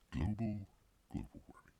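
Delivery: breathy whispered repeat of "global warming"; whispered echo. Acted. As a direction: whisper it like a secret aside. Echoes his own phrase aside.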